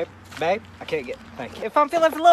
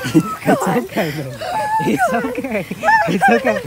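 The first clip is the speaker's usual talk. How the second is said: high reassuring coo. Real, not acted. Comforting the startled bird.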